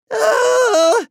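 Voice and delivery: very high, loud startled cry; startled scream. A narrator's reading. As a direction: shriek at the surprise. Kids startled by Dad.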